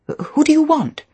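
high, loud, jumpy question; startled. A narrator's reading. Caught at the desk, answering the telephone, startled. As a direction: blurt it out in alarm, pitched right up.

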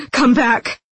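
high, loud desperate cry; anguished shout; wailed call. A narrator's reading. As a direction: call after them in despair. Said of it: "he wailed".

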